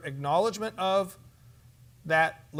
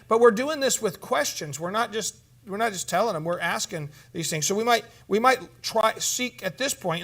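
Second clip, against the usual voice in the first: usual speaking voice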